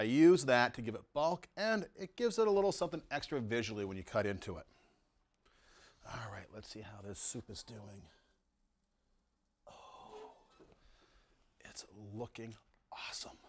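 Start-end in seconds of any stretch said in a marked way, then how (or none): 6.1-13.4 hushed, much softer, leaning in; whispering. Real, not acted. Checking the onion soup in a whisper. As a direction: whisper as if not to disturb the soup. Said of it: "I don't know why I'm whispering".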